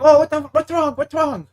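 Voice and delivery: high, loud alarmed boss voice; startled manager. Retold or imitated. Night manager asking why he's yelling, high alarmed voice. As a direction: play the manager jumping at the noise. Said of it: "and the manager's like".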